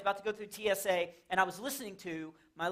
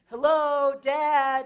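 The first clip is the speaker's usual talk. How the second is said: put-on loud ringtone child voice; 6 semitones higher, 8 dB louder, much slower than usual. Ringtone heard from bag.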